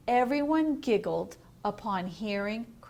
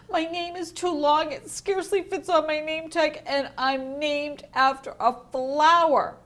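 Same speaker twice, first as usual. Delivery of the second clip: high, loud upset whine; a child's hurt complaint; a daughter pouring out her woes; 6 semitones higher, 5 dB louder than usual. Acted. Upset girl complaining to parents.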